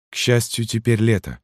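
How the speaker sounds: high, subdued woman's voice; sighing widow. A narrator's reading. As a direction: sigh it out with mild resignation. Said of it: "вздохнула женщина". (вдова, со вздохом)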